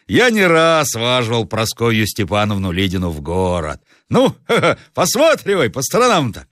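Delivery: high, easy coachman's patter; lightly voiced coachman. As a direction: lightly play a confident driver. Coachman answers the master. (бывалый ямщик, слегка наигранно)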